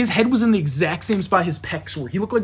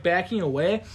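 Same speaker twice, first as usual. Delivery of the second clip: ordinary talk, nothing marked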